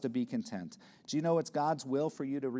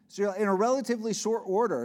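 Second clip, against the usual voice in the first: fast, much louder and higher push; preaching loud; 8 semitones higher, 8 dB louder, faster than usual. Acted. Preacher pressing his flock, loud and fast. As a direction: drive the point home hard.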